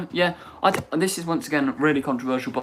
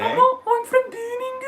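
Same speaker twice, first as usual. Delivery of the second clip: put-on Brummie accent; 3 dB louder than usual. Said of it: "But it's not Brummy".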